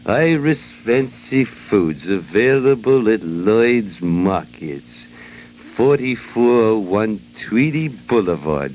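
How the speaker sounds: slow, deliberate sponsor tag; slow ad tagline. Acted. Host closes the sponsor spot, slow ad tagline read. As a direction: spell out the store name slowly for listeners.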